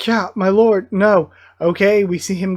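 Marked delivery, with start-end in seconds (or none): none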